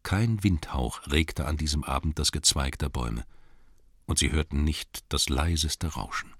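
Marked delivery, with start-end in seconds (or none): none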